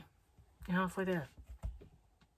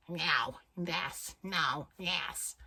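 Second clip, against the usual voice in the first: put-on croaky American accent; 5 dB quieter, much slower than usual. Showing how Americans sound, croaky American accent. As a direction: imitate Americans croaking from far back in the throat. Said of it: "a lot of them croak".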